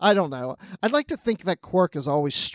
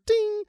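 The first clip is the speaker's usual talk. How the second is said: high sung ding; elevator ding. Retold or imitated. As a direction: chime like an elevator bell. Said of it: "once it gets to that floor".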